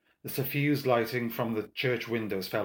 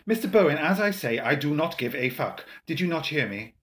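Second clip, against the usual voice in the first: louder, higher, emphatic rebuke; exasperated insistence; 5 semitones higher, 5 dB louder than usual. A narrator's reading. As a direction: raise the voice to hammer it home. Lavelle exasperated, repeats himself.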